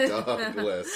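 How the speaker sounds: high, slow laughing words. Real, not acted. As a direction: laugh through the name. Laughing mid-sentence.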